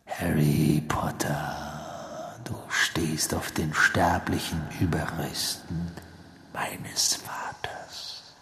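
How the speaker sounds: slow, hissing villain whisper; whispering dark lord. A narrator's reading. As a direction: gloat in a cold whisper. Villain voice held in reading.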